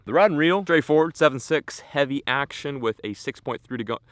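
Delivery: talking too high and muddled before catching himself; garbled high voice. Real, not acted. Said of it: "Speak, speak, talk normal".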